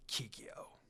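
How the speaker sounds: a stunned, toneless whisper of the name; shocked whisper. Acted.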